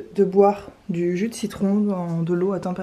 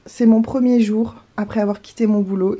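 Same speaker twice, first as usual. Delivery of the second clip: usual speaking voice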